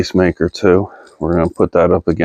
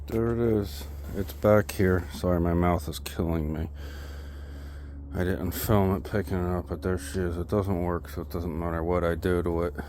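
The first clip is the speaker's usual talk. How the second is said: distant, faint voice; talking away from the camera; far off mic; 16 dB quieter than usual. Real, not acted. Filming from a distance.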